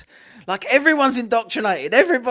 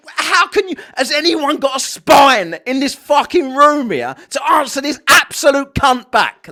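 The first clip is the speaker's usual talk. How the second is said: angry; 5 semitones higher, 6 dB louder than usual. Real, not acted. Angry outburst at the clip.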